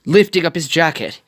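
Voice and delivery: ordinary delivery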